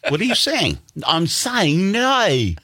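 high, slow Australian "No"; imitating an Australian saying no; Australian accent. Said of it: "Australian people".

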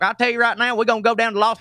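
a hammed-up dumb-guy Southern accent; mock dumb Southern accent. Comedian mocks a TV actor's fake Southern accent. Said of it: "his character was stupid".

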